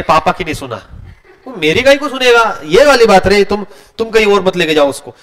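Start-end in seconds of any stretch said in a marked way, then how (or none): none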